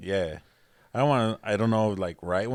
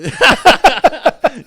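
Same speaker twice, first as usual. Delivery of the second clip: high, loud burst of laughter; real cackle; 14 dB louder than usual. Real, not acted. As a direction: explode laughing.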